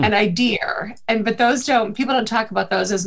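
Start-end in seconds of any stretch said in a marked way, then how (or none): 0.1-1.0 "idea" ending in an R, like "idear"; Boston intrusive R. Shown as a demonstration. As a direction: close "idea" on an added R, Boston-style. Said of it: "words that end in A".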